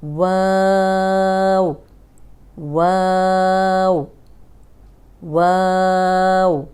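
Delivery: वाव with rounded lips, opening, then rounding again, held long; rounded waw, drawn out. Shown as a demonstration. Teacher demonstrating वाव with lip movement. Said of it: "होंठ आपके पहले गोल होंगे, फिर खुलेंगे और फिर गोल होंगे".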